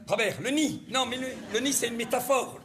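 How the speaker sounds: ordinary delivery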